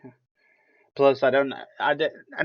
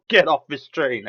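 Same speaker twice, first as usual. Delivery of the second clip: loud, raised mock yell; retold scream; 5 semitones higher, 7 dB louder than usual. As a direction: act out yelling a warning at passengers. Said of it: "screams at them".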